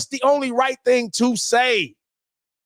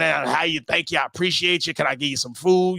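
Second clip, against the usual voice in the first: mock grateful thanks; 6 semitones lower than usual. A rescued man lowering his voice to thank the rivals who helped him, mock humble. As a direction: play the humble grateful man, pitched low.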